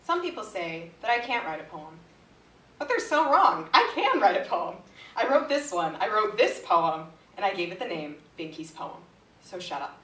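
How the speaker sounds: emphatic, defiant poem, dropping off at the end; mock-defiant poetry reading; comic poem recital. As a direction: punch out the boasts, then drop to a curt finish.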